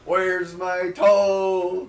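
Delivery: very slow, loud haunting call; ghostly drawn-out demand; spooky ghost wail. Acted. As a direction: stretch the last word into a long moan. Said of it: "it's basically Return the Slab".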